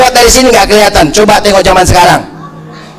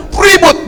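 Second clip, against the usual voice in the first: "ribut" jumping much higher in pitch; high, emphatic "ribut"; exclaimed repeat; 10 semitones higher than usual. Real, not acted. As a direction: hit "ribut" high to stress the racket. Emphatic repeat about noisy children.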